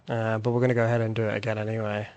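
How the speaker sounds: ordinary talk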